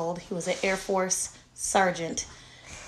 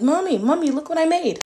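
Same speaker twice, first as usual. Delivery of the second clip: put-on high, loud eager child; excited child calling mom; excited kid; 9 semitones higher, 7 dB louder than usual. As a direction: call out like a thrilled little boy. Mom voicing excited son.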